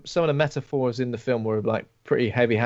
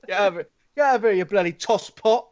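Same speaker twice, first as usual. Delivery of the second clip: high, fast, hammed-up London geezer accent; mock London dub voice; 7 semitones higher than usual. Friends riffing on a Thai film's dub, mock London yell. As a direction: bark it out as a cheesy London dub. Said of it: "from London".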